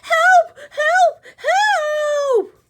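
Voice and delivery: very high, loud panicked shouts; frantic hippo screams; hippo yelling help. Acted. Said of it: "cried the hippo".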